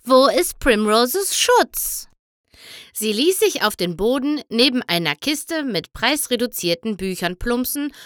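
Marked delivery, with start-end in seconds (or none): 0.0-2.1 higher, slow, exasperated question; moaning, drawn-out plea; exasperated groan. A narrator's reading. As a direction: draw the question out in frustration. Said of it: "stöhnte Twilight".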